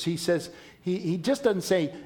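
ordinary talk

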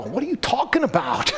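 lightly voiced cartoon fish; silly fish voice. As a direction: play a baffled talking fish. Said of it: "the fish would go".

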